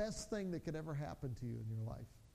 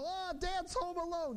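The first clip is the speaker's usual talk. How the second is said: put-on high, drawn-out gleeful kid voice; kids' excited cry; 10 semitones higher, slower than usual. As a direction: imitate thrilled kids, pitched way up.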